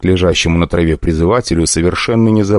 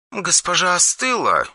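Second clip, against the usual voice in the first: higher, softer Yulia, a little embarrassed; awkward; 12 semitones higher, 6 dB quieter than usual. Acted. Yulia to the hero, softer, higher and awkward. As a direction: say it awkwardly, unsure. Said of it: "в голосе Юли чувствовалась неловкость".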